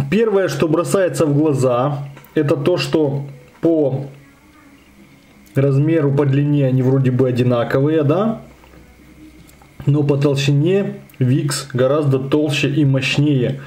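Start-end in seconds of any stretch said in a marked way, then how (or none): none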